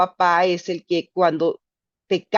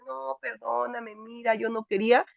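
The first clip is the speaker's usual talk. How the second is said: soft pleading partner; 3 semitones higher, 6 dB quieter than usual. Retold or imitated. Cheating partner begging forgiveness.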